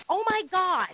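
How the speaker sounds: high, alarmed exclamation; retold shock. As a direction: imitate your own shocked reaction. Retelling shock at seeing a knife.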